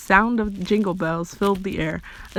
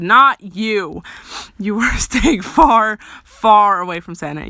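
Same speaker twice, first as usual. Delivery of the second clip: loud, laughing mock scolding; emphatic refusal; 7 dB louder, slower than usual. A narrator's reading. Friend orders Jordan away from Santa, loud and insistent. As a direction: forbid it loudly, half laughing.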